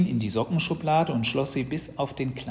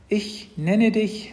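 put-on slow, solemn boy's proclamation, pitched high; high, loud and stately; 10 semitones higher, 6 dB louder, much slower than usual. A narrator's reading. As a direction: announce it with great ceremony, drawn out. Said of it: "sagte Matz feierlich".

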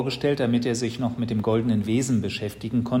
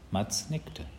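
ordinary delivery, nothing marked

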